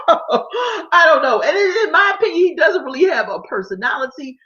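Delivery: high, loud laughing through the words; can't stop laughing while talking; words squeezed out between laughs. Real, not acted. Laughing at own opinion.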